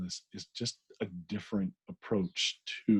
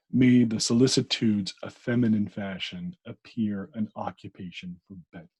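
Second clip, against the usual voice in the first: reading aloud; 5 dB louder than usual. Acted. Reads novel passage aloud.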